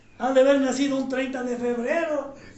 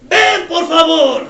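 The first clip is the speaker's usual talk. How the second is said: much louder, calling out each line; shouted prayer lead; 3 semitones higher, 13 dB louder, slower than usual. Real, not acted. Pastor leads the standing congregation line by line, shouting. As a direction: shout the prayer for the church to echo.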